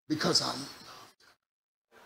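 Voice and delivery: soft, slow and sincere; tender. Real, not acted. Preacher telling the congregation why he served her, soft and slow. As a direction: let the love sink in gently.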